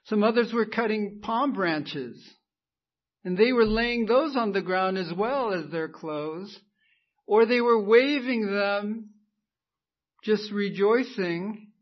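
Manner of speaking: loud, rising sermon build; preaching at full force. Real, not acted. Pastor stirring the church, full-force preaching. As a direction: preach it out to the congregation.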